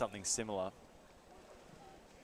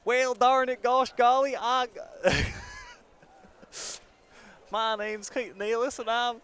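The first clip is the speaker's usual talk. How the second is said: exaggerated high folksy American accent; hammed-up gee-whiz American drawl; 12 semitones higher, 9 dB louder than usual. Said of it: "What's your best American accent?".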